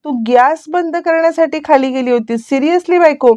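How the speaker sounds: angry husband, half-acted; irritated outburst. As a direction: snap at the wife in disbelief. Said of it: "Arjun चिडतो".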